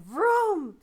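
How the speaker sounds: a loud, high imitated thunder rumble; loud vocal thunder effect; thunder vroom. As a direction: boom it out like thunder rolling in.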